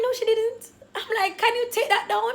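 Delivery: high, loud flustered protest; flustered denial. Retold or imitated. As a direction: push back, voice shooting up. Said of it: "so flustered".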